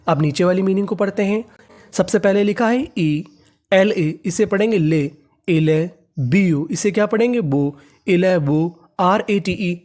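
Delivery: ordinary talk, nothing marked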